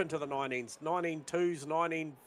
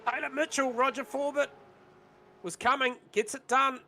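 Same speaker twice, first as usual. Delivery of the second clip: excited call; 8 semitones higher, 3 dB louder than usual. Real, not acted. Live overtake call.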